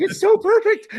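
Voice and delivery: high, loud squeal of delight. Retold or imitated. Band member delighted by the six six six address, squealing. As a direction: squeal it like it's the best news ever. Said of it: "I'm like".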